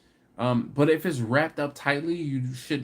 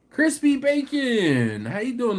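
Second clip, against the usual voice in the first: excited greeting; 10 semitones higher, 5 dB louder, slower than usual. Real, not acted. Greeting chat viewer loudly.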